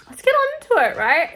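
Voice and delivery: fed-up squeaky demand; impatient. Real, not acted. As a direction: demand they get on with it. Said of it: "I'm running out of patience".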